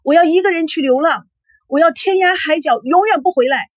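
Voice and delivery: high, overwrought declaration; hysterical vow. A narrator's reading. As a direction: cry out that you are leaving. Daughter's hysterical vow to leave.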